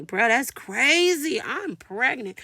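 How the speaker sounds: high, drawn-out incredulous exclamation; stunned disbelief. Retold or imitated. Patient told she is pregnant, stunned disbelief. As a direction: stretch it out in acted shock. Acting out shock at the news.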